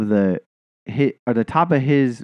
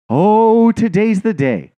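louder, higher, drawn-out gloating cat; gleeful predator cat; 10 semitones higher, 5 dB louder, slower than usual. Retold or imitated. The cat watching the bird, slow gloating relish. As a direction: savour it slowly like a cat about to pounce.

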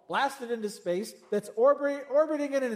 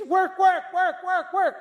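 higher and louder, hammed-up frantic busyness; mock frantic; 9 semitones higher, 8 dB louder than usual. Mocking Martha's nonstop busyness, rising frantic. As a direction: chant it like someone who can't stop.

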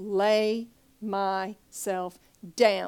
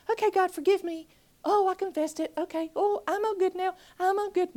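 higher, light, glib mock prayer; mock breezy prayer; 9 semitones higher, 3 dB louder, faster than usual. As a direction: brush it off like a shallow believer. Mocking a shallow prayer.

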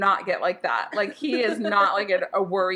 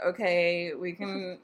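reluctant, low grudging okay; begrudging; 3 semitones lower, 5 dB quieter, much slower than usual. Retold or imitated. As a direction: give in unwillingly, trailing off. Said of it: "like, begrudgingly".

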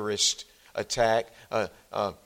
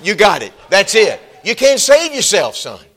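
much higher, louder ringing approval; emphatic parents; 8 semitones higher, 13 dB louder than usual. Retold or imitated. Parents affirming the boy, loud and emphatic. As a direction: proclaim it to the boy. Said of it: "they said".

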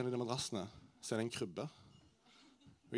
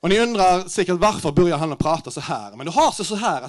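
loud, higher Norwegian-Swedish mix with rolled R; hammed-up Scanian accent, talking big; mock Skåne Swedish; 4 semitones higher, 9 dB louder than usual. Stand-up telling crowd how he spoke at work, hammed-up Skåne accent. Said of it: "Så jeg bør gjøre prata skånska".

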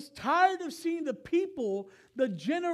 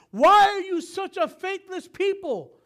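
higher and louder, an exasperated Jesus scolding; exasperated rebuke; 8 semitones higher, 8 dB louder than usual. Retold or imitated. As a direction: cry it out like a fed-up teacher. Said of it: "'Cause you can hear".

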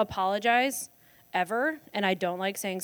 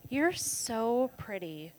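softer, drawn-out fake compliment; veiled insult; 2 semitones higher, 8 dB quieter, much slower than usual. College friend slipping an insult past people, fake compliment. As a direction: stretch it out as a sweet-sounding put-down. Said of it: "what we said in college as an insult".

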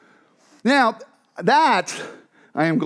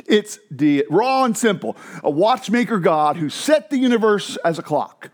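ordinary delivery

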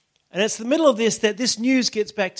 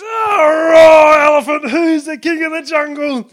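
very high, loud roaring shout; a lion shouting at the elephant; lion's roar shout; 10 semitones higher, 14 dB louder, slower than usual. Acted. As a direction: bellow it like a cocky lion.

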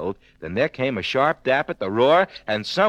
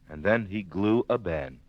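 ordinary delivery

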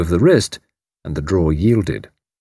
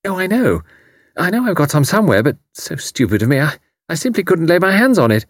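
higher, fast flustered protest; hurried, foolish apology; cried quickly; 9 semitones higher than usual. A narrator's reading. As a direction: rush out the excuse. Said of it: "Tuppence cried quickly".

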